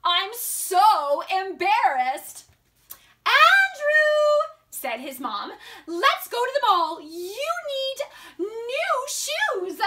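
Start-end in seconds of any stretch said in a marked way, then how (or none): 0.0-2.5 "so" stretched out and loud in "so embarrassed"; embarrassed emphasis. Acted. Title read in character. 3.2-4.5 high, loud sing-out of a name; mom calling out. Acted. 5.9-9.8 high-pitched storybook mother, stretching the last words; coaxing mom. Acted.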